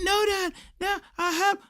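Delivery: put-on very high pleading kid voice; lightly mocked boy pleading; imitating son.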